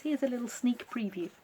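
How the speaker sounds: ordinary delivery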